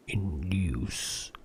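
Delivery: slow eerie whisper. Pretend. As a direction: whisper it like a spell. Repeating word in varied voices.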